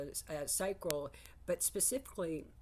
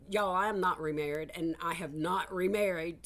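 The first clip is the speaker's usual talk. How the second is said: emphatic declaration; 2 semitones higher, 7 dB louder than usual. Real, not acted.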